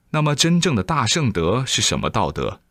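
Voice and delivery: usual speaking voice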